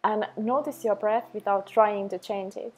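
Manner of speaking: calm, even meditation voice with long pauses; unhurried, level delivery for a self-love practice; guided meditation. Acted. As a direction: guide the listener gently into the breath, leaving space after each phrase.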